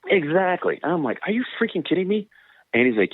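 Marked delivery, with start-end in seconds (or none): 1.3-2.3 high, outraged "freaking kidding me"; mock outrage at the coach; exasperated disbelief.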